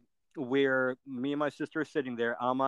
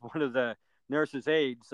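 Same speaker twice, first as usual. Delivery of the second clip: ordinary talk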